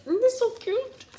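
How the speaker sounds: high-pitched adoring coo. Real, not acted. Expectant mother showing viewers a cute picture, cooing.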